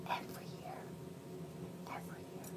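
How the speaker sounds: hushed, breathless whisper. Real, not acted. Telling viewers about free trips, in a whisper. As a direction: lean in and whisper it like a secret.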